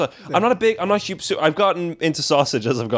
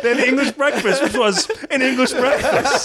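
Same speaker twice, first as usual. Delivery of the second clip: laughing; 10 semitones higher than usual. Real, not acted. Mocking the redundant breakfast name.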